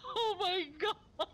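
slow, high, laughing oh my God; embarrassed laughing. Retold or imitated. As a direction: replay your mortified shock while laughing. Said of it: "I was like".

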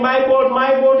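high mock wail of a child; imitated crying. Boy waking at midnight for his lost boat, imitated crying. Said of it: "is crying".

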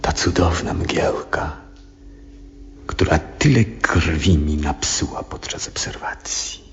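soft fond whisper; quietly whispered reminiscence; hushed whisper. Acted. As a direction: whisper it like a cherished memory. Fond memory of the mist.

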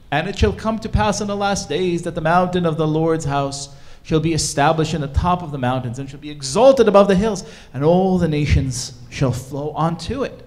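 loud, measured, declaimed verse; scripture proclamation. Acted. Speaker reading Isaiah's vision to the audience, grand proclamation. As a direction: proclaim the prophecy with full weight on each phrase. Reads Isaiah's prophecy in full voice.

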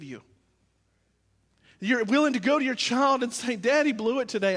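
high, loud pulpit delivery; full-force preaching. Real, not acted. Pastor confessing his failings to the congregation, impassioned preaching.

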